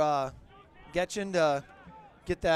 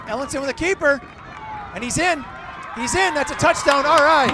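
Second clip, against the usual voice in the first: high, loud shouted celebration; excited touchdown call; 6 semitones higher, 10 dB louder than usual. Real, not acted. Announcer calls Ellenson's touchdown, excited shouting. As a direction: build to a triumphant shout. Calling his team's touchdown.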